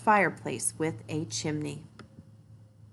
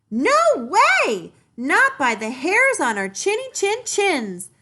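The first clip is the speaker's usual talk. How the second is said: much higher, loud squeaky pigs; defiant pigs' cry; 11 semitones higher, 8 dB louder, slower than usual. A narrator's reading. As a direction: shout back together, brave. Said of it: "cried the three little pigs".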